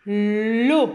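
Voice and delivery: L'eau, read out slowly and clearly as one syllable; syllable read aloud. Shown as a demonstration. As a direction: model the syllable for children. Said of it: "je le lis". (syllabe l'eau lue clairement)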